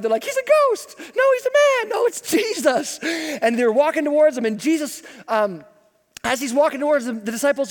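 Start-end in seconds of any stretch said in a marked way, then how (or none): 0.2-3.0 high, panicked squeals; mock terrified. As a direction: shriek it like scared fishermen in a boat. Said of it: "the disciples are terrified".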